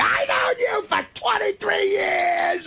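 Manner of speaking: high, slow mock drunken scream; imitating a drunk scream. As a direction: belt it like a sloppy toast. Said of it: "He's screaming".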